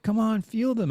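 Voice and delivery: a hint of a flirty local woman; high mock woman's voice. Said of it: "She's like".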